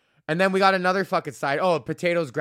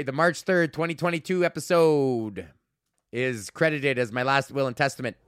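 usual speaking voice

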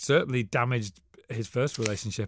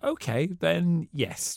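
slow resigned; 4 semitones higher, much slower than usual. Retold or imitated. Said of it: "I was like".

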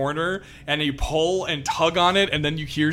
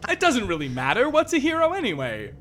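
higher, giggling dismissively; lightly laughing, higher npc voice; 6 dB louder than usual. Acted. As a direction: laugh it off, condescending. Zell laughs off heroes.

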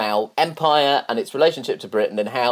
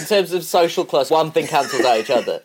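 laughing; 5 semitones higher, 6 dB louder than usual. Real, not acted. Teasing guest about gap year.

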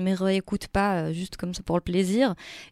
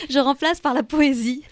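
laughing; 7 semitones higher, 10 dB louder than usual. Real, not acted. Laughing at her own joke.

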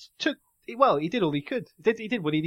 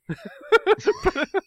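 high giggling fit; helpless laughter; 13 semitones higher, 4 dB louder than usual. Real, not acted. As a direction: laugh until you can't stop. Bursts out laughing.

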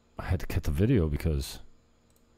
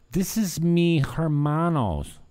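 mock Spanish; 9 semitones higher, 3 dB louder, slower than usual. Mock Spanish intro of friend.

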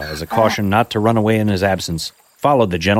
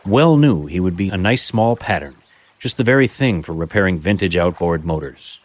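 story narration; 6 dB louder than usual. A narrator's reading. Reads a Dickens story aloud.

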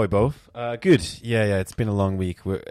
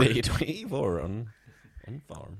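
mock Bristol accent; 6 semitones higher, slower than usual. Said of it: "Isn't Bristol more pirate".

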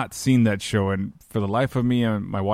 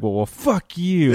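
mock cussing reply; 7 semitones higher, 4 dB louder, slower than usual. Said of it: "he's like".

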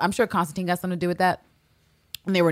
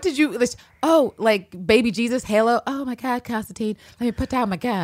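mock awe; 6 semitones higher, 3 dB louder than usual. Mock awed reaction to exposed man.

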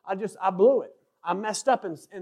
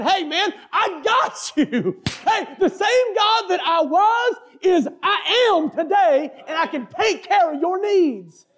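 God; 8 semitones higher, slower than usual. Acted. God reassuring a worried preacher, proclaimed in a preaching voice. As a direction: call out the promise at full preaching force. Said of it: "it was just God saying".

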